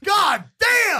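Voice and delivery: shocked shout. Real, not acted. Reacting to cut shaft.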